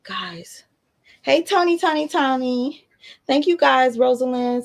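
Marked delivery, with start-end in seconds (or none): 0.0-0.6 unvoiced whisper; whispered aside. Real, not acted. Mother whispering to viewers while holding the baby. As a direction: breathe "Guys" as a hushed aside.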